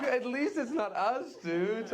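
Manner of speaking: gleeful laughing relief. Real, not acted.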